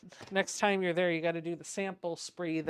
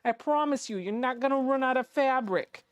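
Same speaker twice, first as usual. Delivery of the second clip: imitated New York mobster accent, higher pitched; 5 semitones higher than usual. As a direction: play a reassuring New York mobster, voice raised. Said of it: "sounds like a mobster from New York".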